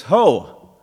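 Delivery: loud high Ho; a loud high alerting "Ho!"; called out Ho. Shown as a demonstration. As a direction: call it out sharply. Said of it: "in some translations it says".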